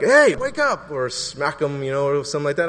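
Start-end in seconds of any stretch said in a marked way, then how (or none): 0.0-0.9 much higher, loud "Hey, wake up"; a loud shout at a sleeping child; rough wake-up. Shown as a demonstration. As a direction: jolt the kid awake. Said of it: "I'm like".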